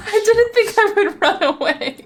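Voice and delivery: gleeful, laughing and loud. Real, not acted. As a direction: giggle through the words. Laughing at outcome.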